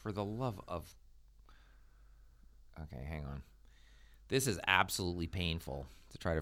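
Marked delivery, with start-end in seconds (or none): 2.8-3.4 hushed under the breath; whispering. Real, not acted. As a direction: mutter it to yourself. Frustrated, searching booking site.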